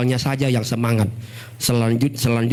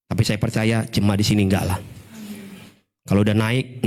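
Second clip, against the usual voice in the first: normal talking voice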